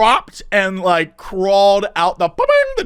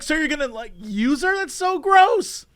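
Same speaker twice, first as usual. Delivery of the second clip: disgusted; 6 semitones higher than usual. Retold or imitated. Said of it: "I was like".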